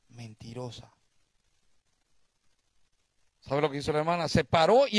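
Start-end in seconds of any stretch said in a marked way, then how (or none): 0.1-0.9 barely voiced, much lower and softer; a secret word breathed into her ear; whispered into ear. Deacon whispers the accusation in the sister's ear, hushed. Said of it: "en el oído le dijo".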